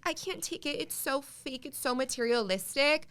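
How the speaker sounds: mock LA complainer. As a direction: parody the dramatic person quitting LA. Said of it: "the stereotypical reason of".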